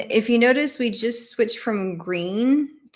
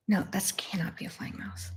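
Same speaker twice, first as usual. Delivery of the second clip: soft whisper to herself, much quieter; puzzled whisper; 11 dB quieter than usual. Real, not acted. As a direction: mutter the doubt in a whisper. Puzzling over a card.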